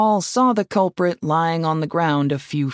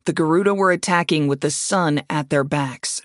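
ordinary delivery, nothing marked

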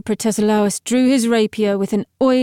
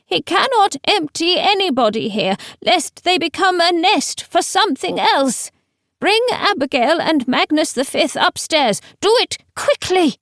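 high, panicky urging; terrified old man; 8 semitones higher than usual. A narrator's reading. Teacher urges them to bring the bodies upstairs, terrified. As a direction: order them urgently in a frightened high voice. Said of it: "replaced by wild terror".

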